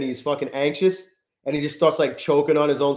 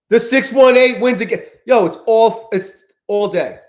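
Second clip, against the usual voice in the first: high, loud hype over the win; hyped shout; 7 semitones higher, 7 dB louder than usual. Real, not acted. Trader hypes his viewers about the level that keeps winning, loud and high. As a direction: crow about it like a winning call.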